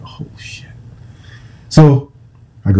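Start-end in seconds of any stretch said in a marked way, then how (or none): 0.0-0.7 breathless whisper of dread; whispered oh shit. Retold or imitated. Player whispering as secretary confirms coach waits, whispered. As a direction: whisper it, caught out. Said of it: "And I go".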